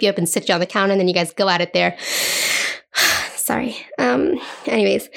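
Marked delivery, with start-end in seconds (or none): 2.0-3.3 long, breathy, lovestruck sigh; dreamy swoon sigh. Pretend. Swooning after picturing a sexy kitchen scene, a dreamy sigh. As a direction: sigh as if lost in the fantasy.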